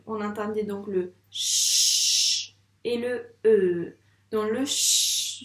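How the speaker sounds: ch et e étirés lentement; détacher chaque son; sounds drawn out. Shown as a demonstration. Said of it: "pour le che". (sons ch et e étirés et appuyés)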